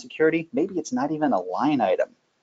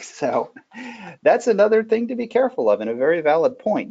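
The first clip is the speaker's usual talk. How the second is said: ordinary talk, nothing marked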